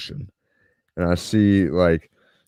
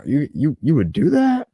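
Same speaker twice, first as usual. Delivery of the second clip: incredulous; 10 semitones higher than usual. Retold or imitated. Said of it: "And he's like".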